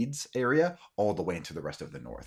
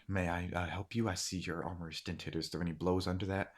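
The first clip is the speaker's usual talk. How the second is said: soft, hushed enquiry; softly spoken, tentative concern; gentle offer of help; 9 dB quieter than usual. Acted. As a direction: offer help quietly to the wounded. Commoner woman gently offers help.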